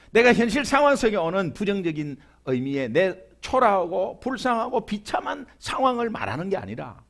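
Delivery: ordinary delivery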